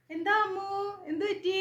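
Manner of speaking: concerned neighbour voice; worried neighbour calling. Retold or imitated. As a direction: call out like a neighbour rushing in. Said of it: "എന്നും ചോദിച്ചിട്ട്".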